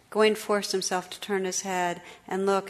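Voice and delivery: plain reading voice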